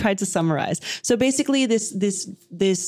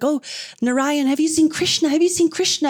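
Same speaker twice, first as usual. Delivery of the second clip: lightly voiced higher, urgent asking; searching gopis; 8 semitones higher than usual. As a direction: gopis calling out as they search, acted lightly. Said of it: "the gopis are running past looking for him".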